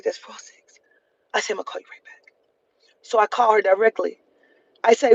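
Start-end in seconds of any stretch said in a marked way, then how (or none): 0.0-0.8 quiet mumble. Retold or imitated. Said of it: "She's like". 1.5-2.3 quiet mumble. Retold or imitated. Hanging up quietly.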